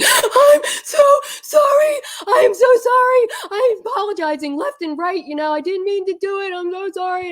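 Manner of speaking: high-pitched mock wailing apology; hammed-up crying, easing off toward the end; imitated sobbing. Daughter calls back to apologize, mock sobbing. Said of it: "she is sobbing and crying".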